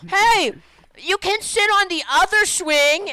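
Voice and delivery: high, loud mock yell; imitated calling out. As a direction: shout after someone in a put-on voice. Imitating kid calling after him.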